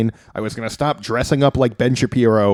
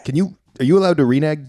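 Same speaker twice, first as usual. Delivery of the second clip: ordinary talk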